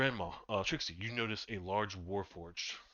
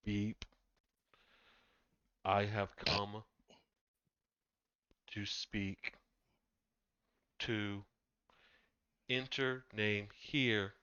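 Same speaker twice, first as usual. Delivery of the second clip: slow stone monotone; much slower than usual. Acted. GM voices robot.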